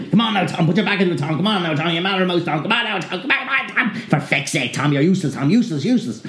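high, jeering demon voices; taunting demons. Acted. Little demons taunting Tom in high jeering voices. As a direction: goad him like mocking imps.

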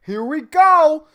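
eager forehead voice. Pretend. Balding man voicing his forehead, excited and loud. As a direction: voice a forehead gearing up to grow. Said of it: "my forehead's like".

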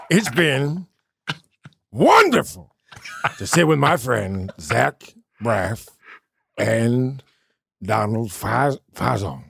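slow Pacino voice, words stretched; a hint of Pacino, drawn out. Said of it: "a quick Al Pacino".

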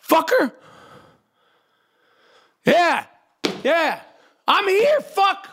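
screaming up at the noisy neighbors, pitch way up; yelling at the ceiling. Real, not acted. Yelling up at the stomping upstairs neighbors, high furious rant.

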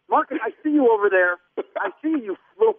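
ordinary talk

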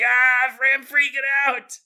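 high, loud hammed-up freak-out; mock panic. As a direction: play a listener losing it. Listener voiced in panic.

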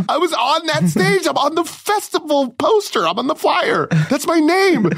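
very high, outraged whine; retold tantrum in a squeaky high pitch; indignant protest. Performer on the poster arguing with guard, indignant. Acted row with guard, pleading credentials.

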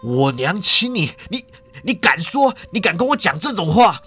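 stammer, then climb into high outrage; sputtering rage. A narrator's reading. Son hearing his mother did the kissing, sputtering rage. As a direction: choke on the words, then explode. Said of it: "气得连话都说不清楚了".

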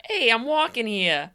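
loud, hammed-up East Coast accent; mock Boston accent. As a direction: holler it like a Boston tough guy, accent thick. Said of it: "Mark Wahlberg's accent".

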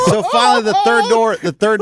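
laughing high as he starts the line. Real, not acted. Teller laughing at his own stadium mishap. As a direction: laugh out the words. Laughing while telling the story.

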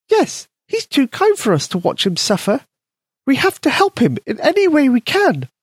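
high, tiny mouse voice; squeaky mouse voice. A narrator's reading. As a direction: voice a small eager mouse. Mouse Polka speaks.

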